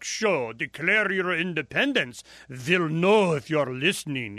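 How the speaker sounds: loud, high mock German accent. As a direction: play a stern official with a thick German accent. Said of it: "German accent".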